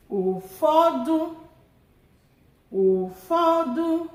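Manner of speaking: Ụfọdụ drawn out slowly, pitch rising; teacher modelling Ụfọdụ for students; slow Igbo word. Shown as a demonstration. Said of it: "let's do the tone marks together".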